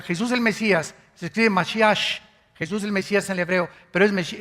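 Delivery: usual speaking voice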